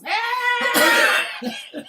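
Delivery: hammed-up top-of-the-lungs scream; very high, loud mock scream; screaming for help. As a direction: imitate a shrieked cry for help. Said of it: "screaming at the top of his lungs".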